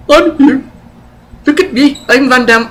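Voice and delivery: mock a show-off star in a bad East Indian accent. Reviewer mocking Van Damme's screen persona in a bad East Indian accent. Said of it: "Why am I doing a bad East Indian accent".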